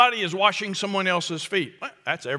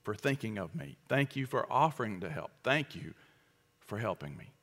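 much softer, lower "Thank you", gentle and grateful; humble gratitude; 7 semitones lower, 10 dB quieter than usual. Retold or imitated. Humility speaking to a helper, soft grateful thanks. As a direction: receive the help gently. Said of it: "Humility says".

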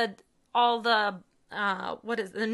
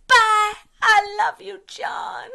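put-on high, loud toddler goodbye yell; imitated child's holler; 11 semitones higher, 11 dB louder, slower than usual. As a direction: shout it like an adoring little kid. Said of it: "Wyatt was at the door yelling".

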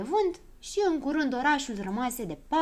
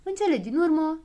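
ordinary delivery, nothing marked

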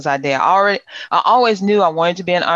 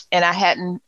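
normal talking voice

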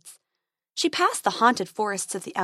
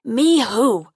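dragon voice; 7 dB louder than usual. A narrator's reading. Dragon questions.

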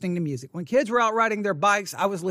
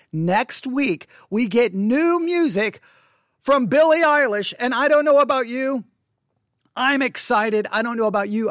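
excited; 5 semitones higher, 5 dB louder than usual. Real, not acted. Excited announcement.

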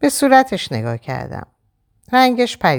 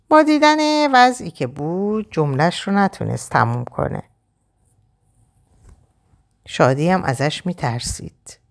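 ordinary delivery, nothing marked

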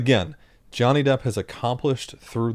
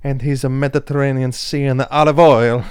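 hammed-up Italian accent, louder; mock Italian; 4 semitones higher, 8 dB louder than usual. Hosts riffing on Godfather, mock Italian accent. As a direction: play a swaggering Italian godfather type.

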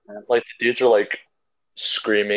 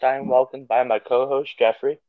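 normal talking voice

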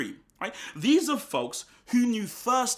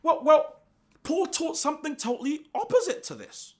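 mock objector; 12 semitones higher, 4 dB louder than usual. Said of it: "you'll say".

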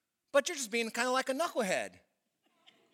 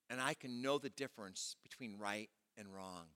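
soft, low, intimate prayer; hushed heartfelt prayer to God; quiet prayer; 7 semitones lower, 9 dB quieter than usual. Acted. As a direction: pray it softly, as if alone with God. Prays Solomon's request himself.

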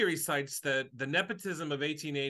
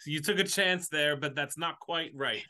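usual speaking voice

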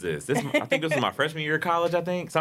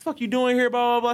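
high, rattled-off mock tirade; angry; 11 semitones higher, 3 dB louder than usual. Boyfriend questioning his girl at the table, angry. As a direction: imitate a heated boyfriend. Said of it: "his anger was not at me".

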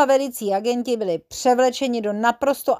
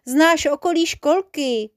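put-on higher-pitched little-boy plea; coaxing, raised-pitch request; pleading; 7 semitones higher, slower than usual. A narrator's reading. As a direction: beg like a small child, voice lifted. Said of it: "zaprosil Jeroným".

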